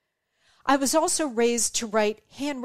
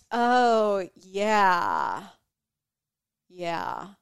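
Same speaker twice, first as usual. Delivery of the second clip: dismissive shrug; 5 semitones lower, much slower than usual. Retold or imitated. Rude person shrugging off complaint.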